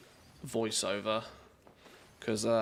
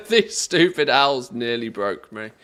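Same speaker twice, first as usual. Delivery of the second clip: laughing exasperated complaint; 8 dB louder than usual. Real, not acted. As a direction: laugh through the words. Laughing at owl puzzle.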